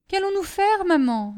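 high, lightly voiced girl; worried child. Daughter asking mother what to do, worried. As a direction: ask mother with a touch of worry.

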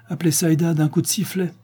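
light, high-pitched quip; joking. A narrator's reading. Lupin teasing Patricia about the danger, joking lightly. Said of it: "plaisanta Lupin".

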